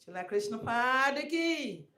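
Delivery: high, loud ringing call; loud chanted jaya call; devotional call-out. Real, not acted. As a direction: call out the glorification to the assembly.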